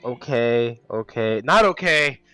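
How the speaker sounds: rising loud panic okays; panicked loud. Acted. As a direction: freak out loudly. Character panics at news.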